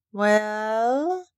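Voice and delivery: long drawn-out, rising "well"; slow, pondering drawl; slowly. A narrator's reading. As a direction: stretch it out slowly, sliding upward. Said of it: "said Ben Weatherstaff slowly".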